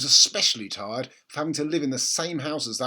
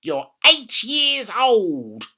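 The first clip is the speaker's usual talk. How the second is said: put-on slow, higher, louder old woman's command; bossy grandma; 9 semitones higher, 10 dB louder, much slower than usual. A narrator's reading. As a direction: lay down the rule slowly. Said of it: "she snorted".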